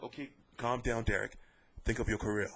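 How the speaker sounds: low, soft, smooth voice of reason; a calm mediator settling down a raging boxer, low and soft; Adam Smith calming voice. Retold or imitated. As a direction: soothe an angry fighter quietly. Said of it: "he's got that smooth, calming voice".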